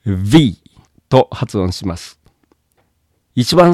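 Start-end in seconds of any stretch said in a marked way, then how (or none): 0.1-0.6 V as "vee", buzzing on the bitten lower lip; English V. Shown as a demonstration. Teacher modelling the letter, V in English pronunciation. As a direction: teeth on lower lip for a voiced V. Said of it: "下唇を噛みながら".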